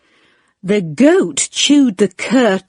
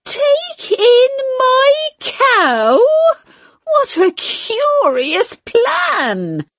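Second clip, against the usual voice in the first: much higher, slow, tearful cry of disbelief; aghast old lady; 12 semitones higher than usual. A narrator's reading. Old lady cries out at the cow idea, much higher and tearful. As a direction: wail it in disbelief. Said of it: "said the wise old man".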